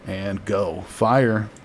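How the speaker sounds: normal talking voice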